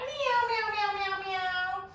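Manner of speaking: high, meek cat meows; scared cat meow. Shown as a demonstration. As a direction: meow like a frightened kitten. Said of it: "for your scared cat".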